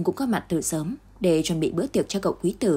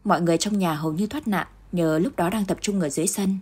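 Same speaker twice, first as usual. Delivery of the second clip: ordinary delivery, nothing marked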